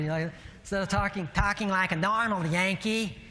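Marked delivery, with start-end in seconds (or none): 1.3-3.2 exaggerated Yankee accent, pitched up. Shown as a demonstration. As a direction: ham up a Yankee way of talking. Repeats phrase in put-on Yankee voice.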